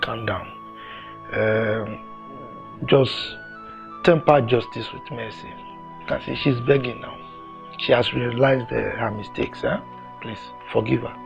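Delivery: low, soft, measured plea; calm appeal. Acted. Elder asking a man to forgive her, quietly calming. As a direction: softly counsel mercy in a low voice. Elder gently asks for mercy.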